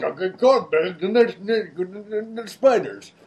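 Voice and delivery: unintelligible mock Scottish ramble; drunken-sounding garble. Imitating an actor needing subtitles, garbled drunken ramble. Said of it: "that's how I speak when I'm drunk".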